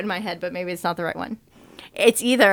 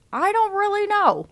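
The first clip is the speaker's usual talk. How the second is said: higher, mumbled through a full mouth; mouth stuffed with food; 11 semitones higher than usual. Retold or imitated. Quoting Remy's brother talking with his mouth full. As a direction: say it like a rat chewing something gross. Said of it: "And the brother goes".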